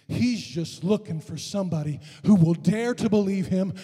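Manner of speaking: high, loud, building preacher's cry; impassioned revival climax; full-force preaching. Real, not acted. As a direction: build to a drawn-out shout.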